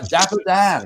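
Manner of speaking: big excited game-show call; announcer intro. Real, not acted. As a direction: announce the guest with a flourish. Host introduces guest with applause.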